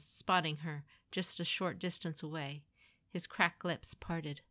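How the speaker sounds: usual speaking voice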